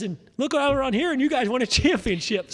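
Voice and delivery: high put-on accent, complaining; imitating Felix's accent. Retelling Felix's complaint to the crew, high mock accent. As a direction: pitch it way up in his accent. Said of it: "he's got that accent".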